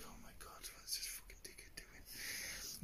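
under his breath, barely voiced; whispered aside. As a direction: whisper it so the boss can't hear. Muttering about coworker crashing stacker.

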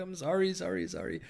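high, flustered, repeated apology; panicked apology. Retold or imitated. Man to the screaming woman in the toilet, panicked.

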